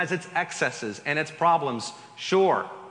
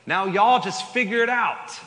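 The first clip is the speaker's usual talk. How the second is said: put-on God shrugging in a Southern accent, higher and louder; casual Southern accent, voice raised; 6 semitones higher, 6 dB louder than usual. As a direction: toss it off like a laid-back Southern God. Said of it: "God just had a southern accent in that".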